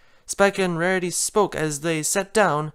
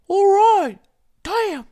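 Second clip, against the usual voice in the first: much higher, resigned sigh; sighing Rarity; 5 dB louder, slower than usual. Acted. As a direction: give in with a sigh. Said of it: "Rarity sighed".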